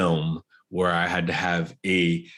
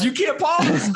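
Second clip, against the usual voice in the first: very high, playful shout; high-pitched jokey outburst; mock-outraged protest; 4 dB louder than usual. Real, not acted. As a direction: shout it as a teasing objection, about to laugh. Teasing protest repeated, then laughs.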